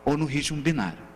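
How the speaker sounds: ordinary talk, nothing marked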